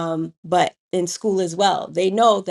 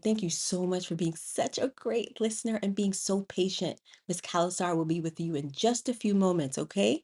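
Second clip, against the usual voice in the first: put-on soft, gentle teacher praise to a waiting child; 10 dB quieter than usual. Teacher softly praising a patient child, gentle and quiet. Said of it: "And I said".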